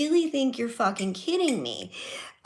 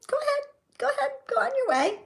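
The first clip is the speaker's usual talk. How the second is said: much higher, fast, sing-song sarcastic send-off; mock-sweet sarcasm; much faster than usual. Daughter replaying telling caught mom to go on, higher, fast, mock-sweet. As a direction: sweetly wave her off, dripping with sarcasm. Catching mom sneaking a smoke.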